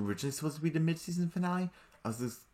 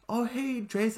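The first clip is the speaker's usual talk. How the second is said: a bright high-pitched "oh hey" greeting; 12 semitones higher, slower than usual. Retold or imitated. Vlogger mimicking a character greeting a friend, high voice.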